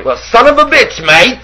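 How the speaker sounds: high, camp Irish put-on with a flip of the wrist; mock Irish accent. Cohost teasing his friend with a hammed-up Irish accent. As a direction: send up an Irish tough guy, hammed-up.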